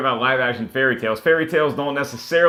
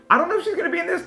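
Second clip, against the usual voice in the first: high squeaky voice; much faster than usual. Acted. Film host teasing about Cinderella, in a high squeaky voice. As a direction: jump up into a high squeaky register.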